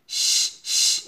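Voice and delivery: শ as a long voiceless hiss. Shown as a demonstration. Teacher models the sound of shin, hissing শ. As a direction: let only air out on a sustained শ. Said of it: "এভাবে শব্দ করেন".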